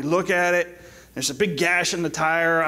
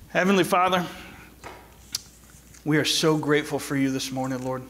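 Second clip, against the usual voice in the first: pastor's prayer. Acted. Leads closing prayer.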